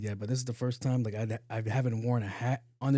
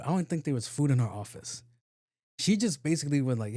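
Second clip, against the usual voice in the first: usual speaking voice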